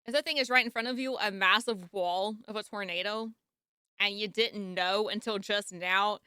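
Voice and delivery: ordinary talk, nothing marked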